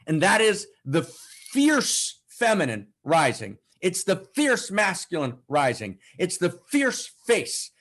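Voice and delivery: rousing, emphatic preacher of outrage; impassioned preaching. Real, not acted. Preacher urging listeners to access their outrage, impassioned. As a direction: drive each phrase home with punched stresses.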